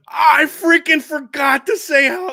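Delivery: high, loud, drawn-out retold yell; mock mad outburst. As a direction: wail it in exaggerated frustration, stretching the words. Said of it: "I was so mad I forgot to say it".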